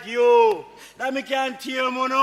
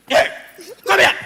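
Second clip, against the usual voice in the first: loud, higher, abrupt order; raised voice; 6 semitones higher, 7 dB louder than usual. Acted. Man orders woman to approach, raised-voice command. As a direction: call them over forcefully. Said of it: "let me raise my".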